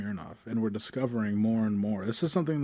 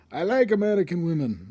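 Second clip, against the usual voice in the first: Nelson Mandela impression; 7 semitones higher, 6 dB louder than usual. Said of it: "as read by Nelson Mandela".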